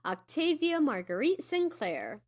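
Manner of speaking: normal talking voice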